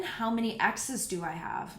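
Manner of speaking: ordinary delivery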